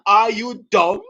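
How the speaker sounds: teasing "Are you dumb?" with a rising sneer; mock insult, playful and loud; mock put-down. Teacher teasing the class with new slang, mock put-down. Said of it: "this is a new English slang".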